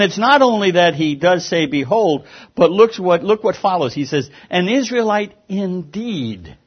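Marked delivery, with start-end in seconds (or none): 4.5-6.6 "An Israelite indeed" drawn out slowly, each word weighted; emphatic quote. Shown as a demonstration. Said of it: "Look at the emphasis".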